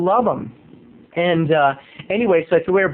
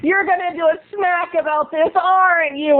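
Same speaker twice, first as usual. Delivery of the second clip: high mock accusing whine; imitating Laura; 10 semitones higher than usual. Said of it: "she's like".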